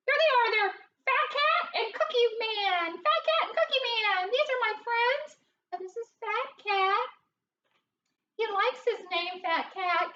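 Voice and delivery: high, squeaky cartoon mouse; Minnie Mouse's regular voice. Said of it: "this is my regular voice".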